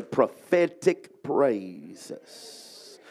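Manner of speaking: "Prophetic praises" stretched out very slowly; drawn-out phrase. Shown as a demonstration. Preacher modelling the phrase for neighbors to repeat, slow. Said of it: "Look at your neighbor and say".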